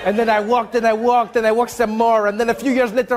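hammed-up French-accented storyteller; wandering Frenchman telling his tale in accent. Comedian as French immigrant recounting his walk, mock French accent. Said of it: "Your accent".

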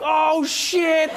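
high, drawn-out mock gasp of words; shocked crowd reaction. Onlookers seeing someone snap at the president, mock shock. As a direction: imitate stunned onlookers in a high voice.

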